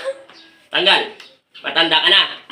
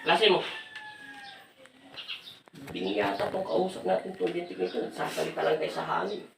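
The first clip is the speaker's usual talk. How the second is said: soft, lowered side comment; quiet aside; 2 semitones lower, 15 dB quieter than usual. Real, not acted. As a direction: drop right down as if talking aside. Aside while spirit stays silent.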